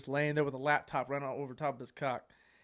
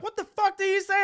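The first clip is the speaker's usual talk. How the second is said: high, loud mock outrage; shocked outcry; 8 dB louder than usual. Girl reacting to roommate's greeting, high mock shock. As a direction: imitate her shocked, high-pitched question.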